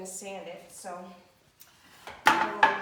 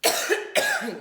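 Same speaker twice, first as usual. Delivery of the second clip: loud dry cough; real coughing fit from dust; 7 semitones higher, 15 dB louder than usual. Real, not acted. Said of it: "I'm already coughing from the dust".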